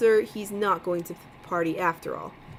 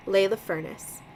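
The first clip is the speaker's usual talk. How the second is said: ordinary delivery, nothing marked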